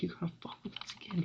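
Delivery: high, fast, hushed mock scream; stifled pretend yell, quick and high; retold scream. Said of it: "he starts screaming".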